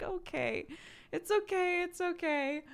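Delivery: high-pitched, gentle reassurance; retold cooing comfort; soothing parent. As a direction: comfort an upset little one. Retold soothing of upset child.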